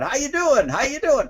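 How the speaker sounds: high sing-song repeated greeting; chirpy salesperson on autopilot; booth greeter. Retold or imitated. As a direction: call it out to everyone walking past. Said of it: "people standing in their booths going".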